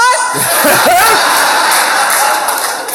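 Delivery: high wheezing laugh; breathy high laugh. Real, not acted. Comedian cracking up retelling the phone number request, breathy laugh. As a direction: break into a silent high giggle.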